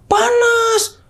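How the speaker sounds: very high-pitched mock tantrum wail; tantrum cry. Girlfriend crying out to Van for help, high-pitched tantrum. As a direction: imitate a tantrum in a high, drawn-out childlike screech. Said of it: "Cewek gua tantrum, teriak".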